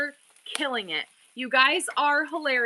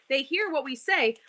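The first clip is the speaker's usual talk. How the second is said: normal talking voice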